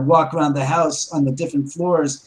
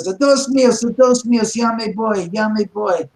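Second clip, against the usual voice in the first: worker crying out in fright as he flees; retold panicked scream; 5 semitones higher than usual. Frightened worker yelling in Spanish as he flees. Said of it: "I screamed".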